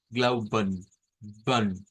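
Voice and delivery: "glauben" with a reduced, swallowed ending; German demo. Shown as a demonstration. Teacher models the word for students to repeat. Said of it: "vamos a decir la palabra glauben".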